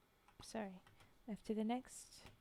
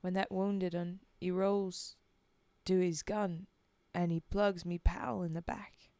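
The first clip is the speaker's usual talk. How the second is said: put-on Cockney-ish accent; 3 semitones lower, 7 dB louder than usual. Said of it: "that original accent".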